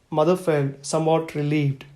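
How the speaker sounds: ordinary delivery, nothing marked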